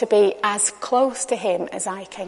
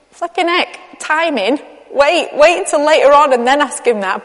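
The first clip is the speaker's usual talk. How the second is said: higher-pitched mock exasperation; acted-out disbelieving reproach; exasperated; 4 semitones higher than usual. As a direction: react aghast at someone's bad timing. Acting out exasperated reaction to disciples.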